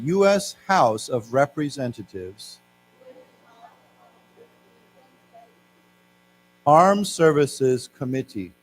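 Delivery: slow, careful repeat for the class. Shown as a demonstration. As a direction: say it again slowly for students. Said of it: "let's say it again".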